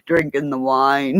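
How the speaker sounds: ordinary talk, nothing marked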